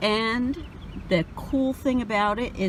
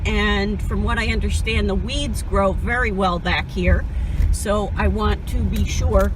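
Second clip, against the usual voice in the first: ordinary talk, nothing marked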